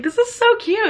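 high-pitched imitation of a delighted friend; excited gushing. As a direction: gush over it as your friend did. Imitating friend running around delighted.

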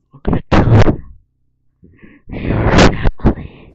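explosively loud pretend-menacing shout; hammed-up screamed threat; mock threat. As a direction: blast out a playful over-the-top threat. Mock threat to kid in game.

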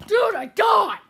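loud, high gamer yell; excited shout. Real, not acted. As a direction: yell it out in disbelief. Laughing shout at game death.

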